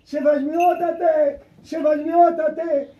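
high, loud, drawn-out call. Retold or imitated. Nun crying out to the bishop while gripping hands, calling out. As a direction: cry out for the bishop, slowly. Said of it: "Και φώναζε".

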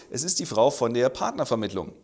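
ordinary delivery

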